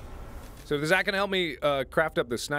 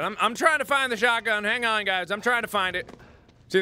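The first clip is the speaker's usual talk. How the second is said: ordinary delivery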